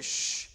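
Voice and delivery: drawn-out hushing "shh"; shushing. Acted. Crowd turns on the blind man, shushing him. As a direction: hush someone who is crying out. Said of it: "then the crowd looks at you".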